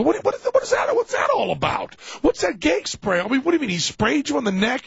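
high, agitated mock outcry; acted incredulous questioning. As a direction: play friends baffled and demanding answers. Acted friends demand an explanation.

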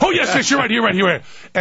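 high, rapid-fire eager yeses; eager reply. Acted. Comic answering the makeup man, high and eager. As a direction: jump in eagerly, starting high and coming down.

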